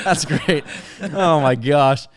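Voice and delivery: ordinary talk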